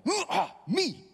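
high, loud mock outburst; flare-up outburst. Preacher imitating himself losing temper, high mock outburst. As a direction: burst out like losing your temper. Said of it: "when you flare up and you're like".

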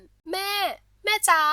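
high, drawn-out call to mother; excited. A narrator's reading. Daughter running to tell mother news, excited. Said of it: "ด้วยความตื่นเต้น".

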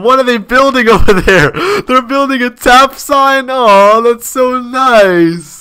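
high, gushing surprise with a laugh; delighted, high-pitched and drawn-out awe; touched delight. Real, not acted. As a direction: gush over a sweet surprise, voice lifted. Touched by fans building a sign.

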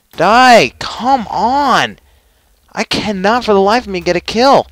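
high, loud frustrated outburst; exasperated yelling. Real, not acted. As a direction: yell at the game, desperate for a kill. Desperate for a kill in game.

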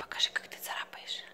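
soft whisper coaxing the cat. Real, not acted. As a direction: breathe it to the cat.

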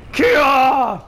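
loud, high drawn-out war shout; battle cry. Pretend. Gamer striking in battle, loud battle cry. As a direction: belt out a high attack yell.

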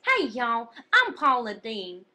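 loud, slow Southern drawl; Paula Deen. Acted. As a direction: show how the Southern cook really sounds. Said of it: "She sounds like this".

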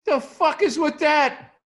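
loud, high, mock yell; mock rant. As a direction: ham up a screaming comic. Mocking a loud ranting comic.

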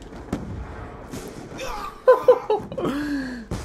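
high, long disbelieving laugh; incredulous laugh. Real, not acted. Laughing at game absurdity.

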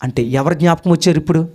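usual speaking voice

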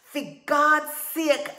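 high, slow, very loud exclamation; blind rage. A narrator's reading. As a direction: explode with exasperation. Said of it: "blind with rage".

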